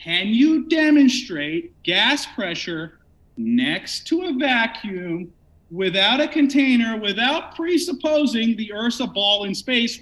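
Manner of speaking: very slow, measured questioning with pauses between phrases; slow, deliberate. Real, not acted. Debater spelling out his question for an opponent, slowly and deliberately. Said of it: "I'll talk slowly so you can get this one".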